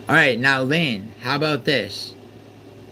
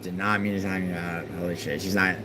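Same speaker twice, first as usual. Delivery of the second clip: softer, lower, stunned murmur; stunned quiet; 5 semitones lower, 7 dB quieter than usual. Real, not acted. Streamer muttering after timing out a viewer, stunned. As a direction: let it drain out in disbelief.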